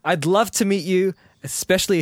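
excited thanks; gushing. Real, not acted. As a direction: gush at Tim warmly.

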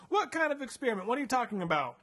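high, alarmed questions; frightened question. Retold or imitated. Kidnapped child asking the doctor about the experiment, high and frightened. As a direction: ask it high and scared. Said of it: "we're like".

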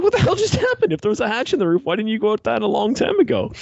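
high, squealing what-the-hell at the screen; exasperated disbelief. Retold or imitated. Yelling at trapped characters about the roof hatch, high exasperated disbelief. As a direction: pitch it up in mock disbelief. Exasperated quote reacting to movie.

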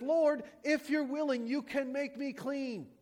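kneeling leper begging Jesus; pleading leper. Retold or imitated. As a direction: beg on your knees. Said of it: "And he says".

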